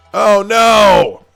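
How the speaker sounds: loud high oh no; surprised cry. Real, not acted. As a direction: react to a sudden failure. Video failed to play.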